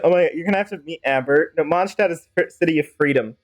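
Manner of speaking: ordinary talk, nothing marked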